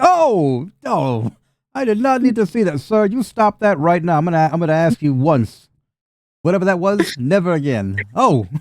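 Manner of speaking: high, loud disgusted scolding; disgusted Rufus. Acted. Rufus telling the goblin brute off, loud and high.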